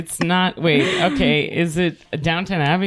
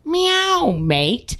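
high meow, then Aussie mate; playful cat with a didgeridoo drawl; 8 semitones higher than usual. Pretend. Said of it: "a cat playing a didgeridoo".

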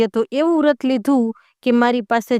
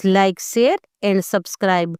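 slow sign-off; much slower than usual. Real, not acted.

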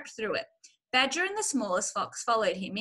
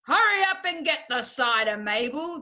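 raised, projected woman's call; 4 semitones higher, 6 dB louder than usual. A narrator's reading. Woman upstairs sending Mabel for cider, calling out. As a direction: holler an order through the house. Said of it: "woman's voice calling out".

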